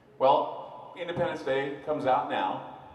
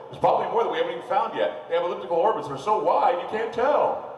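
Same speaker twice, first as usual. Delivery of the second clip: mock casual officials; 6 semitones higher, 6 dB louder than usual. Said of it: "they say".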